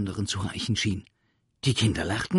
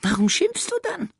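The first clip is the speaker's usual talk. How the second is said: cheeky creature; 7 dB louder than usual. Acted. Sams asks cheekily.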